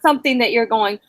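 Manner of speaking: ordinary delivery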